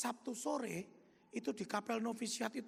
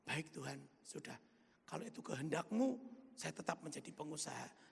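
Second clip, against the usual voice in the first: quiet surrender; 6 semitones lower, 6 dB quieter than usual. Retold or imitated. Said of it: "Terus saya bilang".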